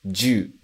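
"ju" as one sound, ju-u; teacher models "ju" in one syllable; contracted juu. Shown as a demonstration. Teacher showing pistol word: "ju" contracted. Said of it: "a pronúncia fica ju".

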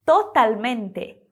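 slow totalmente with a clear L; totalmente, every syllable slowly, L not U; Spanish totalmente. Shown as a demonstration. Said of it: "mas em espanhol é totalmente".

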